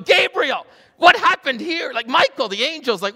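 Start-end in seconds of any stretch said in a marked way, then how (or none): 0.0-1.9 high, loud flustered question; mock panicked God. God anxiously asking Gabriel what went wrong. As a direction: sound bewildered, calling for help.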